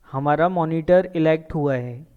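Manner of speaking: normal talking voice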